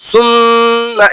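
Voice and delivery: "সুম্মা" with a long hummed ম; doubled mim. Shown as a demonstration. Teacher showing tashdid on mim, long held consonant. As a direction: hold the ম of "সুম্মা" in a long hum. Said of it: "মিমের উপর তাসদিদ".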